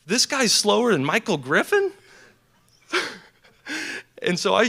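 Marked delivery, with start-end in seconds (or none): 0.0-1.9 high, loud disbelieving question; mock the coach's amazement; incredulous coach. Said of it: "he said".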